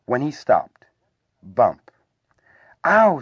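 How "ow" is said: sudden loud, high squeak. A narrator's reading. Something squashed cries out in pain, squeaked. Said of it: "squeaked something".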